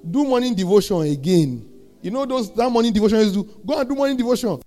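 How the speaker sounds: high-pitched, insistent preaching; exhorting preacher. Real, not acted. Urges the congregation back to devotion.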